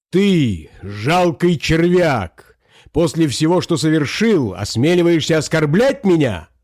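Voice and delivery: scornful empress, voice lifted; indignant rebuke. A narrator's reading. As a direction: berate a traitor from the throne. Empress confronts the usurper with contempt.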